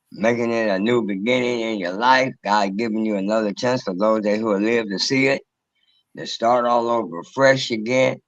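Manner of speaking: ordinary talk, nothing marked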